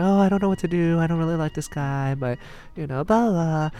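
put-on high-pitched fretting young woman; mock-dithering, pitched well up. Mom venting boy troubles to dad, high mock fretting.

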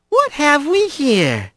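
high, slow, sly female voice; teasing Blaze. Acted. Blaze eyes Rat emerging, slow and teasing. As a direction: savor the surprise, toying with him.

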